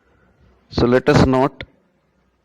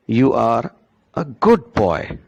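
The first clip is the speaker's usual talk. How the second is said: put-on You are a GOOD boy; stress on good; slower than usual. Shown as a demonstration. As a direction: lean hard into good. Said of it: "My focus was on good".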